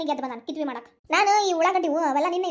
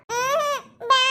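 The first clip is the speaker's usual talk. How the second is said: put-on high-pitched toddler whine, near crying; tearful child refusal; 8 semitones higher, 3 dB louder, much slower than usual. Small child refusing to eat, tearful whine. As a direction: whine it out like a fussy little child.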